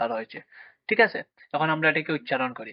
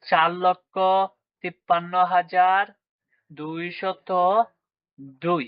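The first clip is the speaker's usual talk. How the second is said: long pauses after লক্ষ, হাজার and দুইশত; slow number demo; 3 semitones higher, much slower than usual. Shown as a demonstration. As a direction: spell out চার লক্ষ, তিপ্পান্ন হাজার, দুইশত, দুই part by part. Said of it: "তাহলে এটির উচ্চারণ কি হলো?".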